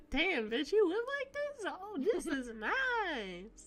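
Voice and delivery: mock awe. Mock awed reaction to room.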